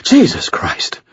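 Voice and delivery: a shaken, breathless whisper; breathed. Acted. Murphy startled by a demonic scarecrow, whispering. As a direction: breathe it out in shock, barely voiced. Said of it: "Murphy breathed".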